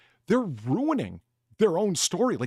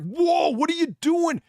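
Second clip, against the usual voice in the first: high, slow mock gasp of a kid; shocked kid; 12 semitones higher, 4 dB louder than usual. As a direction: play the kid stunned by the grab. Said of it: "He's like".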